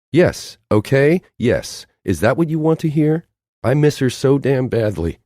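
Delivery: high, loud, cracking admission; anguished outburst. Acted. Noelle confessing to Hector that she misses her friend. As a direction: throw the confession at him in pain.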